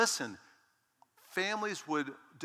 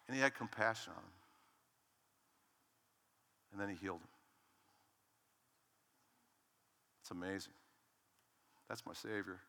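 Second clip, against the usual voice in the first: much softer, intimate preaching; soft testimony; 3 semitones lower, 6 dB quieter than usual. Acted. Preacher sharing his rescue story, soft and intimate. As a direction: let it sink in, quietly confiding.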